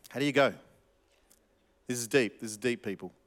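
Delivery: usual speaking voice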